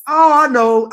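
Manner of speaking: sudden loud eureka; blurting out the answer; excited realization. Real, not acted. Friend guessing the rapper, bursting out excited.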